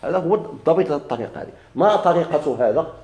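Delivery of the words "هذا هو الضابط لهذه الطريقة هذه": ordinary talk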